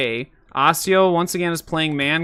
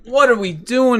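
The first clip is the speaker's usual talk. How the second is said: high-pitched, despairing question; pleading, worked-up frustration; exasperated plea; 7 semitones higher than usual. Real, not acted. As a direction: ask it in helpless disbelief. Despairing at his team.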